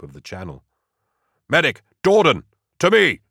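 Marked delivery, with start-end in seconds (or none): 1.5-3.2 high, loud urgent call for help; sharp, raised officer's shout; bellowed command. A narrator's reading. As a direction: bellow for the medic across the fighting. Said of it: "Gaunt bellowed".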